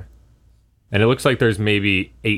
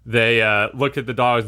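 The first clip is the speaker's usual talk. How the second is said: ordinary talk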